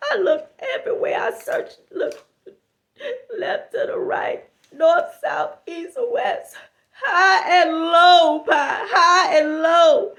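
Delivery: hammed-up weeping lament; mock crying. Friend describing her search, mock weeping. Friend wailing about lost card.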